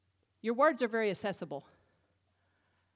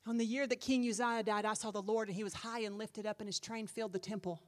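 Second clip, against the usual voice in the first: hushed scripture recitation; 5 dB quieter, faster than usual. Acted. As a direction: recite the vision quietly and steadily.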